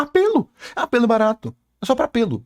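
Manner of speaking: high-pitched exclamation easing down; indignant interjection. Real, not acted. Cutting into the video to call the scene cheap, high indignant outburst. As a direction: cut in high and exasperated. Calls out the played clip.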